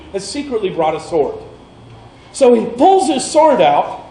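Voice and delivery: ordinary talk, nothing marked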